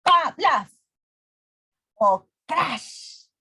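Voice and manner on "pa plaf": "pa, plaf" popped out high and loud; punchy onomatopoeia; slap sound effect. Shown as a demonstration. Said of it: "voy a ponerlo".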